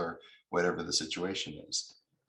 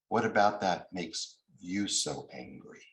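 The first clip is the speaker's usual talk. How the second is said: ordinary delivery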